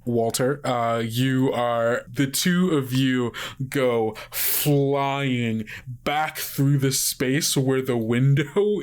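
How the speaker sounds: ordinary talk, nothing marked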